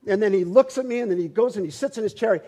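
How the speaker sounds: usual speaking voice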